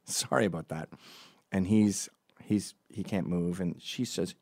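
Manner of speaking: normal talking voice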